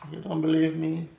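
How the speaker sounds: normal talking voice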